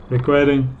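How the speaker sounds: ordinary delivery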